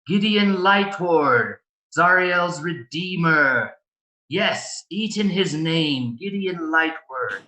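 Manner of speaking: slow, high, raspy zealot intoning. Acted. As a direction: drag it out like a possessed preacher. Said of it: "same raspiness".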